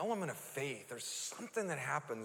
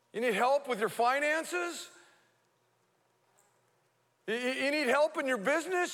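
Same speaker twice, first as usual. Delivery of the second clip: rising, louder preacher's litany; preaching at full force; 11 semitones higher, 8 dB louder than usual. Real, not acted. Preacher calling out needs to the congregation, rising and loud. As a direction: build each question higher and louder.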